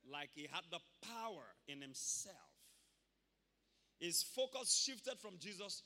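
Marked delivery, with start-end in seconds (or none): none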